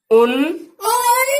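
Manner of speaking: "उन, उन" stretched very slowly and pitched high; drawn-out demo. Shown as a demonstration. Teacher stretching "उन" slowly and high for students. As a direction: sing out each "उन" long and high for the class. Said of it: "dear students".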